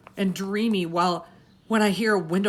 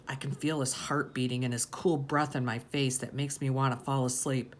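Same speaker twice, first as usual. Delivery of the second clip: ordinary delivery, nothing marked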